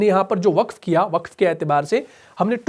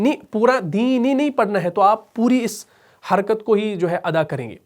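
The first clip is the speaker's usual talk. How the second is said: usual speaking voice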